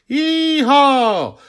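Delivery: very high, loud whoop; joyful yee-haw. A narrator's reading. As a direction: whoop with glee while leaping. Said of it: "I heard".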